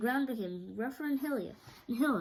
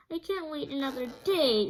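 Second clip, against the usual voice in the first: Scarecrow voice; 6 semitones higher, 6 dB louder than usual. A narrator's reading. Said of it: "said the Scarecrow".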